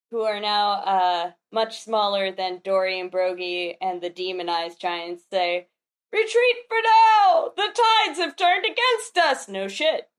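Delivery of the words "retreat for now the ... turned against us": high, loud theatrical shout; acted retreat call. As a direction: sound the retreat as a panicked giant. Acting giants calling a retreat.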